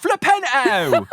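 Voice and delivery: imitating the game's one repeated "Flipendo", no D; hammed-up "Flipendo" sounding like fli-pen-oh; Cockney-ish Flipendo. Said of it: "so you hear a lot of".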